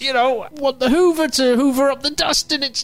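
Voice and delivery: squeaky mock celebrity complaint. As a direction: whine like a fed-up celebrity. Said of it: "that was my impression of [name]".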